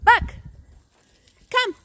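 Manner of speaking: very high call to the dog far off. Real, not acted. Trainer calling Buck in from the yard, high ringing call. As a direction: sing out his name high.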